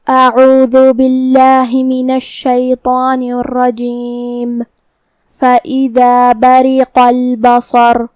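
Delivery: slow tajweed recitation with long-held vowels; Quran recitation. Shown as a demonstration. Teacher opens the lesson's verses, slow Quran recitation. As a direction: recite slowly and evenly, drawing out the syllables.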